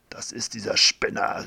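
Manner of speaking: plain reading voice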